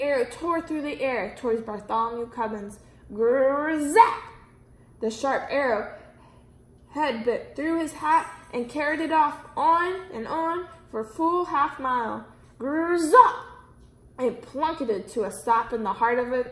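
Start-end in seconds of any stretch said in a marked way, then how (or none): 3.1-4.2 loud buzzing arrow whoosh; zipping arrow noise. A narrator's reading. Narrator sounding the arrow in flight, loud. 12.6-13.4 loud buzzing arrow whoosh; zipping arrow noise. A narrator's reading. Narrator sounding the arrow in flight, loud.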